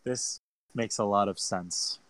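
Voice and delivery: slow, soft, dramatic line read. Acted. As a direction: savor the line slowly and quietly. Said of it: "make it sound more better in the moment".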